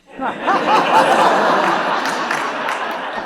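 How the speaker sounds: loud burst of high laughter; big laugh. Real, not acted. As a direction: let out a big bright laugh at your own joke. Laughs at own joke.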